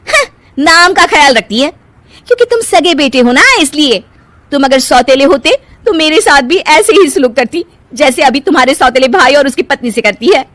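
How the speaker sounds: high-pitched, aggrieved scoff; scornful. Acted. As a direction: dismiss the affection bitterly.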